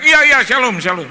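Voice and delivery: high, loud repeated greeting; shouting into the phone. Retold or imitated. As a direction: bellow it so the call won't drop. Shouting into a weak phone signal.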